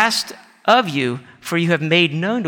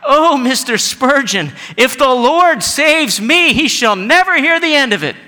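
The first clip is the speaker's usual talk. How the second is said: crying out; 7 semitones higher, 6 dB louder than usual. Acted. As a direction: cry out in overwhelmed gratitude. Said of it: "And she cried out".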